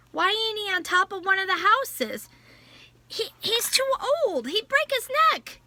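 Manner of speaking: put-on high, anxious child voice; worried child. Child frightened for father.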